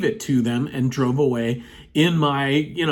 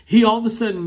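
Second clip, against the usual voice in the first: ordinary talk, nothing marked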